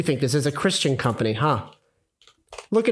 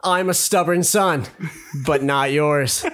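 higher-pitched, deliberate slogan reading; dramatic reading; 5 semitones higher than usual. Acted. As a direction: declaim the shirt slogan with mock pride. Reads shirt slogan aloud.

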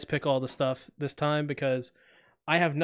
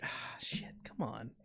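whispered, let-down curse; whispered disappointment; 6 semitones lower, 16 dB quieter, slower than usual. Retold or imitated. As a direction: whisper it, crushed. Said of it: "I was like".

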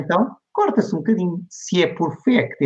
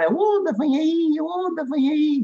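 high-pitched stretched-out warning cry; imitated far-carrying call, high held notes; mock shouting; 13 semitones higher than usual. Lone prophet in the desert shouting a warning, mock yell. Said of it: "a gritar".

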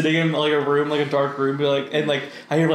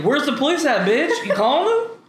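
high-pitched challenge; mock taunt; 10 semitones higher than usual. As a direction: needle them, calling their bluff. Taunts aunt who threatened police.

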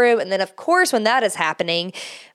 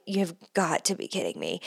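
muttered under the breath in disbelief; exasperated mutter; 2 semitones lower, 10 dB quieter than usual. Retold or imitated. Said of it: "And I'm like".

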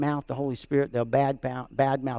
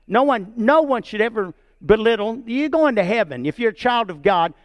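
higher and louder, rising in fervour; fervent preaching; 7 semitones higher, 4 dB louder than usual. Real, not acted. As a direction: preach it with full conviction. Pastor rises to fervent preaching.

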